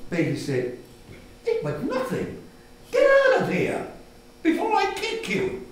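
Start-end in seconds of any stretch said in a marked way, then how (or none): 1.5-5.7 bratty brother threat. Acted. Brother chases Bailey off, high and threatening. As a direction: threaten like a rough older boy.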